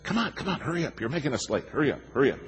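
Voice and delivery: high, pinched nagging to hurry; hamming up the impatient husband; mock impatient nagging. Husband nags his wife down the steps, high and whiny. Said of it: "I said".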